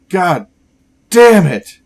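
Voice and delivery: a sudden curse that explodes at the end; furious shout. Acted. Doctor cursing the AI that killed his brother, shouting. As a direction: shout it out, voice rising in rage.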